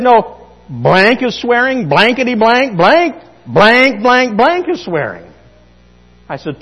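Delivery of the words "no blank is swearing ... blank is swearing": put-on loud, slow boy listing bleeped words; a kid arguing his point; mimicking the boy. Preacher imitating the boy listing what counts as swearing.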